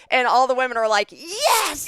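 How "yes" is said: excited cheer. Retold or imitated. Women cheer the switch.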